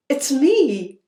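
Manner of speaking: ordinary delivery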